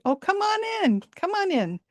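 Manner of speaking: high friendly monkey voice inviting the lost hunter in; old monkey voice. Acted. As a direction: welcome him in like a kindly old monkey. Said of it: "the old monkey said".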